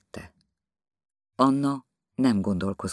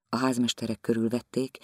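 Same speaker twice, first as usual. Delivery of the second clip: ordinary delivery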